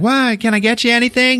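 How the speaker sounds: high, quick waitress voice; mock waitress. Waitress shouting over club music, high and quick. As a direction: chirp it out fast and high. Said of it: "she's like".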